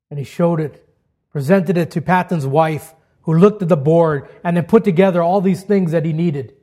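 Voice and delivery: normal talking voice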